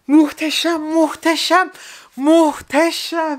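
high, tearful awe, last one slow; choked-up happy wonder; awed joy. Real, not acted. Overwhelmed by signed photo.

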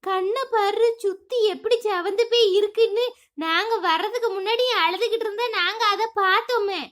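கேலியாகக் கேட்கும் பக்கத்து வீட்டுப் பெண், தாழ்ந்த குரலில்; teasing neighbour woman. Acted. Second neighbour character voiced. (அழுததைக் கண்டுபிடித்த தோழி, தாழ்ந்த கேலிக் குரலில்)

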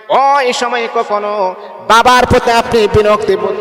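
high, ringing sermon chant; fervent waz preaching, pitched high and loud; impassioned preaching. Acted. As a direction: lift the voice and call out to the crowd. Preacher addresses the congregation.

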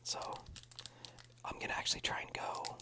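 soft close whisper throughout; ASMR whisper. Real, not acted. ASMR host whispering through a pack opening. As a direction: whisper it all for the ASMR channel.

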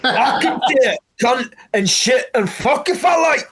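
high, hammed-up promo rant; mock wrestler promo. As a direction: yell it like a wrestler cutting loose.

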